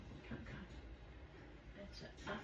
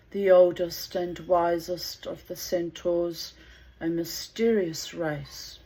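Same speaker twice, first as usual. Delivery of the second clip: story narration; 3 semitones lower, 5 dB louder than usual. A narrator's reading. Narrator reading the myth of Chiron to listeners, measured. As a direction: unfold the myth gravely and deliberately.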